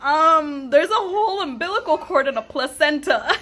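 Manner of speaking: retold objection. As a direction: stretch the um, then state the obvious. Pointed objection to staff retold.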